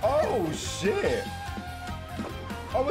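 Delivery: ordinary talk, nothing marked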